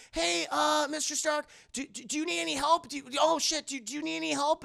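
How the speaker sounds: high, stammering youngster's voice; acted timid, high-pitched offer; nervous Peter. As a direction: offer help awkwardly and eagerly. Peter nervously offers Stark help.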